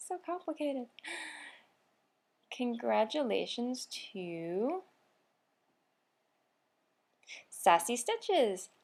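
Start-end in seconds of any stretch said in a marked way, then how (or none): 2.5-8.7 slow winner announcement. Acted.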